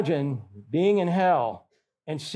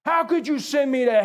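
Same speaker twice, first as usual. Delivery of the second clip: angry protest; 10 semitones higher than usual. Retold or imitated. Churchgoer shouting at God over being sent to hell, furious and high. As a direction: rail at God in outrage, pitch way up. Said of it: "They are so angry at God".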